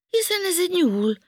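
high, loud astonished cry; surprised high exclamation; amazed. Acted. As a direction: marvel at spotting a hare. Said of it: "ámuldozott Panka".

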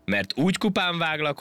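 high, self-important threat; pompous. A narrator's reading. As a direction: threaten as a puffed-up monkey. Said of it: "legyintett nagyképűen a majom". (nagyképűen fenyegetőzve)

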